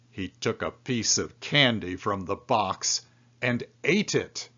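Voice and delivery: usual speaking voice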